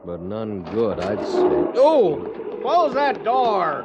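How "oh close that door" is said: loud, high alarmed yell; shout at the door. Acted. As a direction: bellow at whoever left the door open. Man bursts in from freezing cold.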